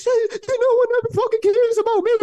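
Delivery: very high, loud falsetto shriek; mock meltdown in a squealing falsetto; hysterical woman. Storyteller imitating neighbor's meltdown as cops get her, hysterical falsetto.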